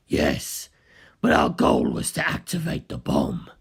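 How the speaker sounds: low voice for Kaz admitting the plan; Kaz's voice. Acted. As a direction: drop the voice well down.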